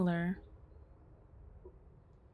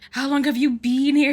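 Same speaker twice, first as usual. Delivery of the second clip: loud, higher mock British actor's American accent; mock Brit-doing-American accent; 5 semitones higher, 9 dB louder than usual. Streamer mocking the narrator's Brit-American accent, loud and hammed-up. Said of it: "get the Brits fucked up when doing an American accent".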